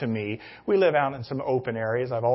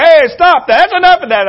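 high, loud retold shout of a man telling someone off; the neighbor shouting at the pastor to leave his dogs alone, high loud angry retold yell; 16 dB louder than usual. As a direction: yell stop at him across the yard. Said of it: "and he says".

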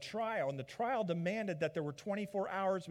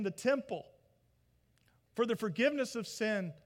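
loud, higher impassioned preaching; a preacher pressing sin and justice, loud; preaching at full force; 5 semitones higher, 5 dB louder than usual. Acted. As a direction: drive the gospel home. Preaching climax.

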